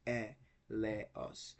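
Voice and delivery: E, le, os in three separate beats; three-part E, le, os; syllable by syllable. Shown as a demonstration. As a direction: evenly separate E, le, os, falling away at the end. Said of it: "we had three syllables".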